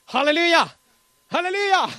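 high, loud joyful cry of halleluja; ecstatic halleluja shout. Real, not acted. Preacher to congregation, ecstatic loud halleluja shout.

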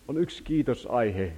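ordinary talk

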